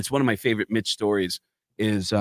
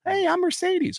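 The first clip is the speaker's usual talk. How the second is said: much higher, girly mock voice; stripper voice. Stripper introducing herself to Gene, high mock girly voice. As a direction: squeak it like a bubbly girl.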